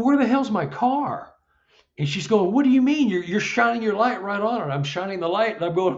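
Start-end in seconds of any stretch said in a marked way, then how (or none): 0.0-1.3 high-pitched, incredulous retelling of his own alarm; retold bewilderment. Retells his shock at missing car. 2.5-4.7 high, louder retold protest; wife's exasperated disbelief. Voices wife contradicting him.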